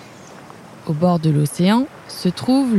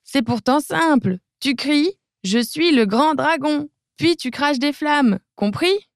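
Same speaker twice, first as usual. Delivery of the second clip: exasperated king; 7 semitones higher than usual. Acted. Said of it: "rugit le roi".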